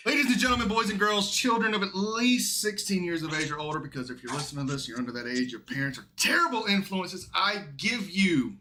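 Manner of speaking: big booming emcee intro; announcer voice. Acted. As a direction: announce the guest like a ringmaster.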